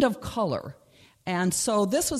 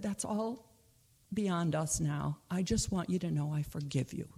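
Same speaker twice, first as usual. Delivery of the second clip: gentle forgiving; 8 dB quieter than usual. Acted.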